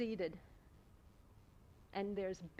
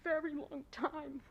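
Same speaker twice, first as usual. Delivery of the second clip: getting emotional; 6 semitones higher than usual. Real, not acted. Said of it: "And now I'm gonna get emotional".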